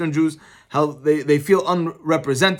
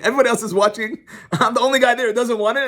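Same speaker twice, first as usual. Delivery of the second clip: ordinary delivery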